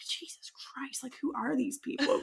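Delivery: a hushed, appalled aside; exasperated whisper. Retold or imitated. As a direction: mutter it in disbelief. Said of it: "he's like".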